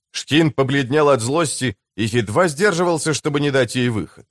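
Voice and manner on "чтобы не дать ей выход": plain reading voice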